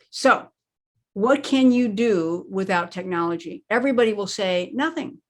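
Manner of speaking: ordinary talk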